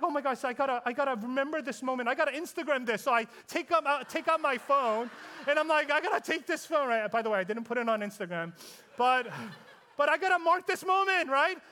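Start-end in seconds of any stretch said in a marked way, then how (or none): 0.0-6.9 excited rush. Retold or imitated. Excited to capture the moment.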